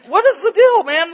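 high, loud incredulous outburst; exasperated bewilderment. Retold or imitated. Preacher retells finding Wesley fishing, exasperated bewilderment. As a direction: blurt it out, hammed-up disbelief.

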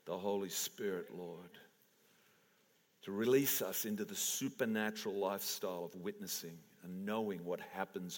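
praying over the room, quietly earnest; earnest prayer. Acted. Prays over the congregation.

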